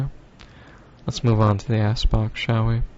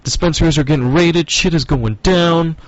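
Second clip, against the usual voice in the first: higher-pitched, loud panicky report; mock freaking out; 6 semitones higher, 4 dB louder than usual. People in the area reporting the raids, mock freak-out. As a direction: act out someone freaking out on their phone. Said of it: "who are freaking out".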